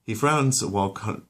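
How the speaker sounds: ordinary delivery, nothing marked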